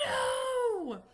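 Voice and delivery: high, loud panicked cry; a sudden alarmed shriek. Retold or imitated. Mom seeing son buy movies, panicked cry.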